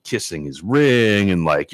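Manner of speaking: ordinary talk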